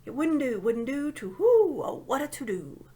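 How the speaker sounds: loud owl hoot on whoo. A narrator's reading. Owl hooting to-do.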